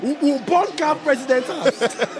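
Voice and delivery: slow, laughing joke. Real, not acted. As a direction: chuckle through the words. Joking, laughing through words.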